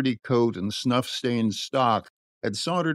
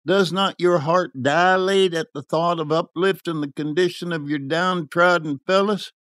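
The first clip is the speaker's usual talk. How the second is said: higher-pitched idealistic appeal; fervent visionary youth; 6 semitones higher than usual. A narrator's reading. Youth pleading for the downtrodden, fervent. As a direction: urge him on with lofty ardour.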